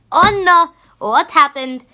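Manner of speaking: retold dismayed exclamation; exclaimed mock concern. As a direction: burst out with alarmed concern for a friend. Retold reaction to crying friend.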